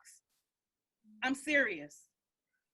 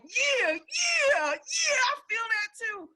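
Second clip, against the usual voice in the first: higher, louder cry of yeah, yeah; excited agreement; 11 semitones higher, 7 dB louder than usual. Retold or imitated. People hearing her truth shout excited agreement. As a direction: shout back eager agreement. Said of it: "people will be like".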